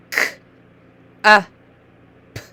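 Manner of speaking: K, uh, p as isolated sounds with gaps; separate letter sounds. Shown as a demonstration. Teacher modelling letter sounds for a new reader, sounded out separately. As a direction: sound out K, uh, p one by one. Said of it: "we've said the letter sounds".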